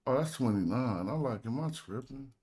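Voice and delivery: normal talking voice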